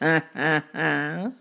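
low staged chuckle; short, deliberate ha-ha-ha; cat's chuckle. Acted. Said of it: "he laughed".

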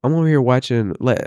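ordinary delivery

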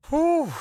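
loud, high whistling exhale; relieved whew. Real, not acted. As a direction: blow out the tension at the end. Exhale after intense story.

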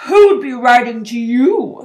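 loud, slow contemptuous sneer; scornful uncle, loud and slow. A narrator's reading. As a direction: sneer it at the boy, drawn out. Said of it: "sneered Uncle Vernon".

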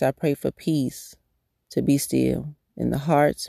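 soft, unhurried prayer voice; spoken prayer. Acted. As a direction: pray it quietly and earnestly, lifting each phrase to God. Prays for the listeners.

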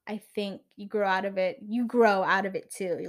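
ordinary delivery

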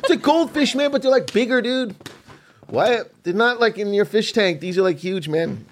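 high, eager mock wonder; excited about fish. Snorkeler describing huge fish to skeptic, excited. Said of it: "grown-ass men get so excited about fish".

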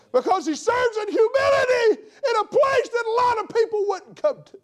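high, loud voice breaking into a cry; tearful preaching. Real, not acted. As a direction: preach it through tears.

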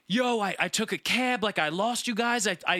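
a hint of a hurt, flustered friend explaining himself; mild whiny friend voice; upset friend. Friend voiced recounting getting lost.

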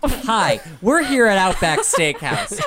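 hammed-up show host voice, loud and high; mock TV host. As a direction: play a bubbly on-location host. Mock live show from Outback.

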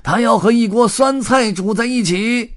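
high, loud tramp's bellow; yelling in a strained high character voice; roared insistence. A narrator's reading. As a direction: shout down the other cooks. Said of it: "红胡子吼道".

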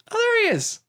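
very high-pitched, sing-out discovery; retold gleeful surprise, pitched right up; mock delighted recognition. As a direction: exclaim it as if spotting someone. Retold spotting of a known actor.

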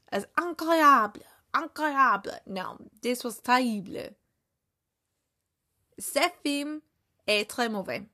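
put-on French accent, mocking a film; badly faked French accent. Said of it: "his accent was probably".

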